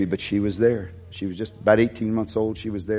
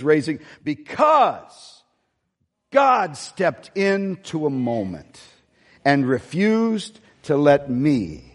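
higher, slowing x0.6 at the climax; preaching climax; 4 semitones higher, slower than usual. Acted. Pastor bringing the congregation to the sermon's key point, slow preaching climax. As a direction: draw out the words to land the point.

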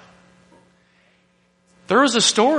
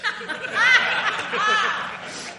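high laugh; 3 dB quieter than usual. Real, not acted. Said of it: "That was for George H W".

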